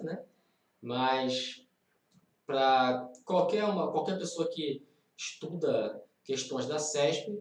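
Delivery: ordinary delivery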